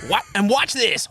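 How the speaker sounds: ordinary delivery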